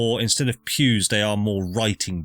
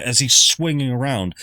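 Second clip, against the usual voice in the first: ordinary talk, nothing marked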